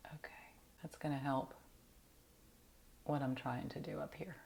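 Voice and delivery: soft whispered aside; whispering. Real, not acted. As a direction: whisper it to yourself while working. Aside while applying powder.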